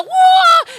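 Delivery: loud high martial arts yell; kung fu battle cry. Retold or imitated. Comic reenacting facing a mugger, belting a kung fu yell. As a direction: belt it out like a kung fu fighter. Belted out a whoa.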